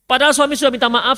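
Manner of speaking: ordinary delivery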